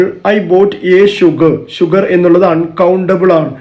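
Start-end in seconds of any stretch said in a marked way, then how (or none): 0.0-1.6 very slow, clear reading of the error; slow wrong example. Shown as a demonstration. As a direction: read the faulty sentence slowly. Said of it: "For example". (പതുക്കെ തെറ്റായ ഉദാഹരണം)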